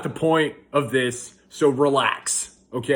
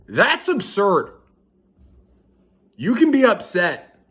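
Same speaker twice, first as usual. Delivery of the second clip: high, emphatic objection; indignant protest; 5 semitones higher, 4 dB louder than usual. Real, not acted. Dad rejecting a minimizing cliche, indignant. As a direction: push back hard on the absurd idea.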